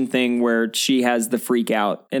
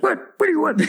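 grumpy executioner; 13 semitones higher, much faster than usual. Retold or imitated. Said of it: "he's like".

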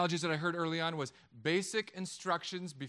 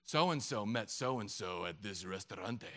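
low, slow soap opera melodrama; hammed-up telenovela drama, drawn out and low; 7 semitones lower, slower than usual. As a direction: play it as a deep-voiced daytime soap. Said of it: "Days of Our Lives soap opera version".

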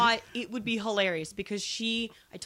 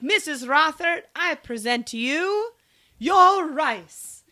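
grand presentation; 6 semitones higher, 7 dB louder, slower than usual. Retold or imitated.

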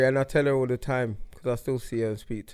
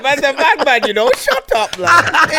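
much higher and louder, hammed-up tough talk; jokey gangster bluster; mock hard man; 12 semitones higher, 9 dB louder than usual. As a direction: play the bad man for laughs. Mock tough-guy boast.

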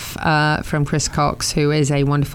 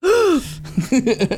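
excited gasp; 9 semitones higher than usual. Retold or imitated. Retold reaction to phone call.

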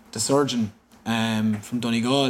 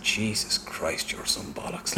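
hushed whisper; 2 semitones lower, 7 dB quieter, slower than usual. Retold or imitated. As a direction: breathe it out in a whisper. Retelling mum's shocked reaction.

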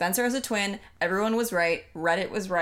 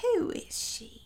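whispered question; 13 semitones higher, 4 dB quieter than usual. Retold or imitated. Voicing a confused character.

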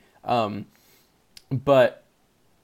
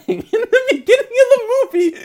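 laugh-crying; 6 dB louder than usual. Real, not acted. Crying over sad opening.

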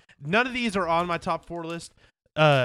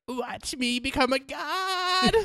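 metal frontman; 10 semitones higher, much slower than usual. Retold or imitated. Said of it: "he's like".

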